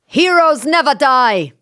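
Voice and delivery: high, slow heroic game-character call; Mercy. Acted. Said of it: "do it in the, as in Mercy".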